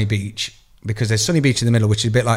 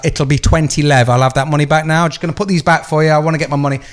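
louder, firm and pushy; bully your way to the refund, louder; insistent demand; 3 semitones higher, 6 dB louder than usual. Retold or imitated.

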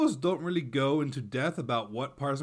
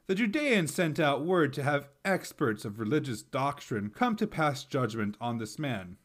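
ordinary talk

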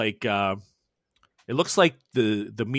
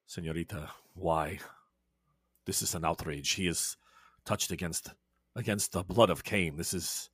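lower, softer, offended Spanish gentleman; low indignant vampire; 6 semitones lower, 8 dB quieter than usual. Acted. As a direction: protest the outrage low and stiffly. Edmundo protests in his voice.

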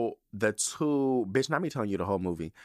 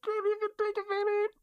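high squeaky voice through laughter; laughing squeal. Real, not acted. Laughing too hard to talk.

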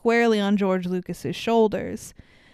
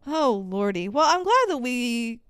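normal talking voice

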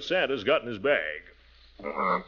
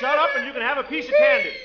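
loud pleading bribe; 13 semitones higher, 7 dB louder than usual. Acted. Bribing the screaming child.

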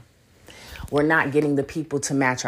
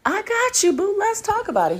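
excited; 12 semitones higher than usual. Retold or imitated. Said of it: "And I was like".

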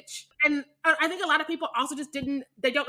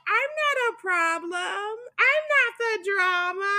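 high, slow and prissy, mock innocent; 8 semitones higher, much slower than usual. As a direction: play the innocent, dragged out and sweet. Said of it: "they're like".

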